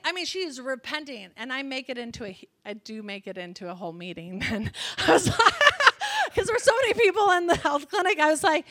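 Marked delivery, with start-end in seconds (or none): 5.4-6.3 real laughing burst; loud laugh. Real, not acted. Laughs mid-story.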